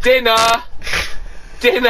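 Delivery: calling dinner. Retold or imitated. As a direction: call everyone to the table. Mum calling family to dinner.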